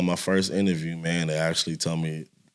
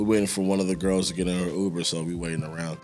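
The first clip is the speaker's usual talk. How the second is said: usual speaking voice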